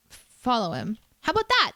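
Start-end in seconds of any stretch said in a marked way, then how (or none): 1.2-1.7 mock triumph. Mock-proud of a silly command.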